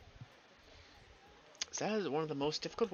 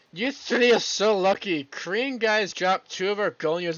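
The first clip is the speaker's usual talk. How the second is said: louder, slow envious complaint; envious outburst; 5 semitones higher, 7 dB louder, slower than usual. Acted. Character envying the three players, loud and slow. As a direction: grumble loudly with envy. Character envies the gamers loudly.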